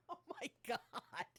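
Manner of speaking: high, slow laughing disbelief. Real, not acted. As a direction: laugh it out in shock. Embarrassed reading own writing.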